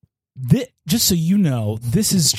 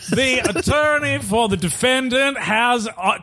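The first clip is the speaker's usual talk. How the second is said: booming judge; 9 semitones higher than usual. Acted. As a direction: proclaim it from the bench.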